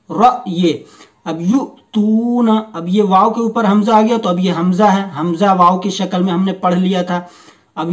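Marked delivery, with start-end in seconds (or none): 1.4-2.6 युतूना slow with a catch before त; slow recitation with glottal catch; hamza jolt. Shown as a demonstration. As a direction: stop sharply in the throat in युतूना. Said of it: "पढ़ने में झटका होगा".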